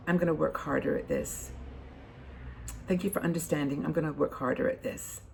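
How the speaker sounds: soft, steady promise to do better. Shown as a demonstration. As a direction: say it softly and kindly, easing off. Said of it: "It's softer, isn't it?".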